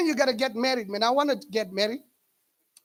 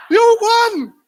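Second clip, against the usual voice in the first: high, loud exasperated "You won."; mock shout to the boxer; 8 semitones higher, 11 dB louder than usual. Comedian shouts at the crying boxer, mock loud disbelief. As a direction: yell it at him in disbelief.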